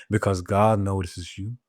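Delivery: usual speaking voice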